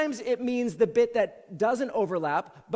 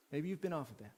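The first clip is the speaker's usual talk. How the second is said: soft repeat; 6 semitones lower, 12 dB quieter, faster than usual. Acted. Softer repeat, pastoral appeal.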